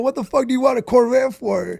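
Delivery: a furious father chewing out his son; angry dad. Retold or imitated. Dad yelling at his son over the new Corvette, loud and outraged. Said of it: "He's like".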